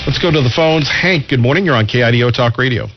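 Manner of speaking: ordinary talk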